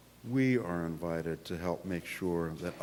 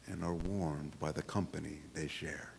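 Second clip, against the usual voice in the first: put-on lively read-aloud for children, pitched up; story narration; 9 semitones higher, 8 dB louder than usual. A narrator's reading. Pastor reading picture book to congregation, storytelling voice. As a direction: tell it bright and bouncy to the kids.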